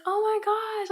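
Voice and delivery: high, loud delighted gasp of a phrase; retold happy shriek; excited squeal. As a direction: squeal it like a thrilled kid opening a gift. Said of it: "And I was like".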